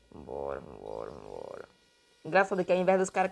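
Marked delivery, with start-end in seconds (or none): 0.1-1.7 deep mock coaxing. Coaxing the machine, very low.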